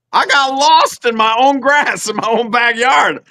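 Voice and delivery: normal talking voice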